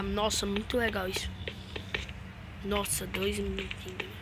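soft breathy whisper. Real, not acted. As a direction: hush it under your breath. Sussurra enquanto joga.

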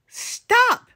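very high-pitched, sudden cry; storybook shout. A narrator's reading. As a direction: shout it into a giant's ear. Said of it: "shouted into the giant's ear".

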